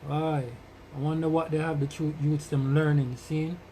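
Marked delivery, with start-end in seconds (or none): none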